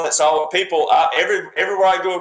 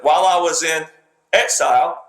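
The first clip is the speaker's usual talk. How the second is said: exile put on a pedestal after a clear pause; imitating a pause that props up exile; pause before exile. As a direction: stop after in, then hit exile hard. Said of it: "he pauses and he puts that word basically on a pedestal".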